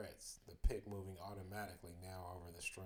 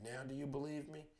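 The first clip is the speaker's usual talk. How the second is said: higher, rising plea, understated fear; a touch of panic, pitched up; terrified; 5 semitones higher than usual. A narrator's reading. Child to parents in the driveway, mildly terrified. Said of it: "hoarse and terrified".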